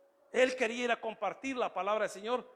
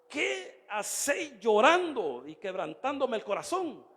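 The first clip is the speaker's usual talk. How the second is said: slow, louder, drawn-out reproach; dramatic rebuke; 3 semitones higher, 5 dB louder, slower than usual. Acted. Preacher voices Paul challenging his weeping brothers, dramatic rebuke. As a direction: stretch it out as an impassioned challenge. Acting out Paul's rebuke from scripture.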